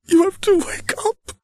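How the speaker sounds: begging a dead friend; frantic young prince. Acted.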